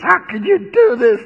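terrified sailors blaming Jonah; anguished groan. A narrator's reading. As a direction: wail at him in dread. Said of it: "they groaned".

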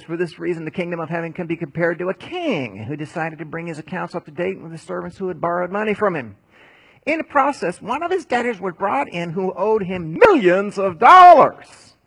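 starts steady, swells to a big loud telling; preaching parable, building. Acted. Preacher telling the congregation the parable, building loud. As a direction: build the parable from reading voice to full preaching force.

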